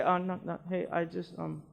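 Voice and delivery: a hushed, hesitant offer at the door; shy neighbour barely offering the cure; timid offer. Retold or imitated. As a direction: mumble it apologetically. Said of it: "go to the door and say".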